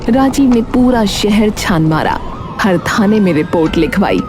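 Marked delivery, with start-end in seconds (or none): none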